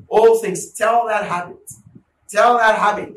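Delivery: loud, high commanding cry; commanding shout. Real, not acted. Preacher shouting at a sinful habit, commanding. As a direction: bark it at the habit, full force.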